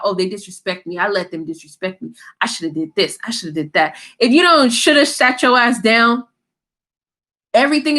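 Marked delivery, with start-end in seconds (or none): 0.0-4.0 a hint of a sulky mutter replaying old hurts; understated imitation of regretful self-talk; mock inner voice. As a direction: lower and softer mock of someone brooding to themselves. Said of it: "situations in your head talking about".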